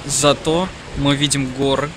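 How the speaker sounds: ordinary delivery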